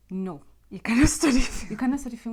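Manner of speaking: loud, emphatic imitated veto; mock forceful refusal. Parent or elder refusing film studies, mock-outraged and loud. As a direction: put your foot down, loudly, half laughing. Acted refusal of study wish.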